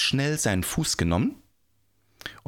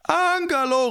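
high, loud, stretched call; 5 dB louder than usual. A narrator's reading. The people shout to the giant, calling out. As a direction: shout up to get someone's attention. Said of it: "riefen die Leute".

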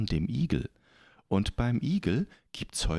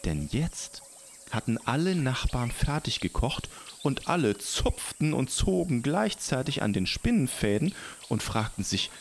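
ordinary delivery, nothing marked

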